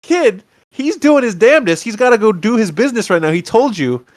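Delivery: high, exasperated mock scolding; indignant outburst. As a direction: tell the kid off in disbelief, pitch up. Viewer's indignant reaction to kid.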